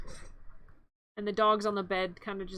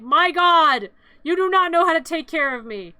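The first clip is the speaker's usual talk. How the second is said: loud, higher indignant outburst; mock outrage; 4 semitones higher, 11 dB louder than usual. Injured pet berates its careless owner, mock outrage. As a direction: berate them with hammed-up exasperation.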